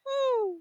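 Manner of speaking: put-on a tiny high hum like a baby alpaca; imitated cria hum. Partner mimics the cria humming during the birth, high soft hum. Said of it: "and the cria goes".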